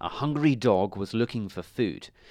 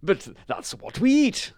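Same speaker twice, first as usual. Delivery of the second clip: a high, puzzled cow's voice; 9 semitones higher, 4 dB louder than usual. Acted. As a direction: answer as a surprised cow, pitched well up. Cow answers the dog.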